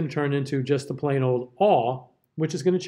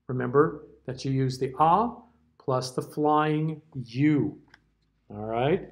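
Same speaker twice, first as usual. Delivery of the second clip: ordinary delivery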